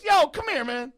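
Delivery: loud, very high mock holler; big guy hollering. Big guy calling the culprit over, amused disbelief. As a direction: call someone over, hammed-up and loud.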